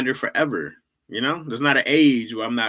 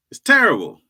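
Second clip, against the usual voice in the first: louder, higher wail on terrible; mock anguish; 7 semitones higher, 11 dB louder than usual. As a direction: cry out terrible in mock despair. Repeats line, louder second time.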